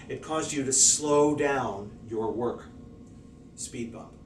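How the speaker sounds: ordinary talk, nothing marked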